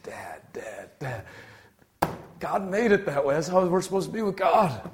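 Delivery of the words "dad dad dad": soft awed call. Retold or imitated. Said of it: "they're just".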